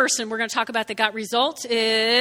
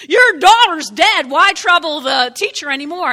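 blunt loud announcement; 4 semitones higher, 11 dB louder than usual. Acted. Messenger from the house tells Jairus, blunt loud announcement. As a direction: blare the bad news out.

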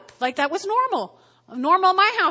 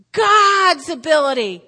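God's punched loud and slow; forceful; 3 semitones higher, 8 dB louder than usual. Acted. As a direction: drive home God's ability loudly. Preacher repeats definition forcefully.

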